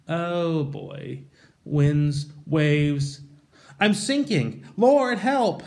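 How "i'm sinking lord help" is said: higher, rising mock panic; panicked Peter. Peter sinking in the waves, hammed-up panicked cry for help. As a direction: cry out as if going under.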